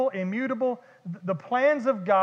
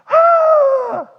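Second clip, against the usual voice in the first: high, loud mock scream of panic; hammed-up freaked-out shriek; panicked scream; 11 dB louder than usual. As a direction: scream like terrified disciples. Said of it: "They run down to him. They're like".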